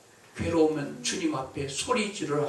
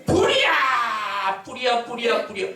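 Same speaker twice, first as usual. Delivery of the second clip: shouting fire; 7 semitones higher than usual. Acted. Person whose house burns, alarmed shouting. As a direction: scream fire at the top of your lungs. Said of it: "이게 진짜야".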